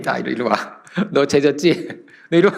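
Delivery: lightly voiced accuser summoning a sinner; playful mock-devil voice; Satan. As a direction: beckon and accuse, half joking. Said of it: "이게 사탄입니다".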